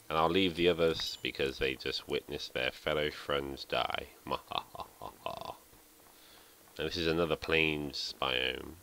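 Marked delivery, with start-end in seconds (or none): 4.3-5.5 evil cackle. Real, not acted. After killing chickens.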